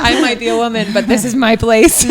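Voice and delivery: laughing declaration. Real, not acted. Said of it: "laughing emphatic declaration".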